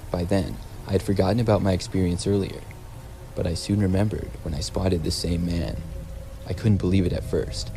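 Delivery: ordinary delivery, nothing marked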